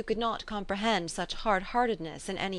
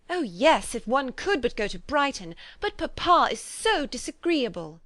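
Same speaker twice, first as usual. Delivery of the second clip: put-on higher, louder girlish complaint; a lightly voiced petulant young girl; whining Lydia; 5 semitones higher, 4 dB louder than usual. A narrator's reading. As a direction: pout it like a spoiled girl. Lydia whines about papa.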